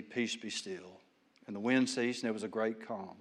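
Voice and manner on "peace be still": soft, hushed command to the sea; Jesus hushing the storm, quiet and firm. A narrator's reading. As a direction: say it low and softer, calm authority. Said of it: "He rebuked the wind and said".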